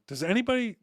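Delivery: high, fast, fed-up rant at the phone ringing; exasperated outburst. Retold or imitated. Retelling to friends how he fumed at Glenn's call, high and rushed. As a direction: throw up your hands: not again, who gave out my number.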